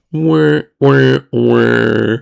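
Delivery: put-on a high, babyish wah wah wah; mock whining. Teller mocking his own sulk with a babyish whine. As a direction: whine like a sulking baby.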